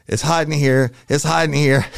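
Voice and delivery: high, loud re-enacted call for help; urgent signal call. Officer signalling his detectives from the stall, urgent high call.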